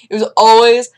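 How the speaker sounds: ordinary talk, nothing marked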